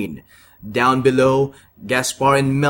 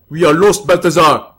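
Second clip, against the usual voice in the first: desperate wizard; 3 semitones higher, 7 dB louder than usual. Acted. Wizard despairs in battle.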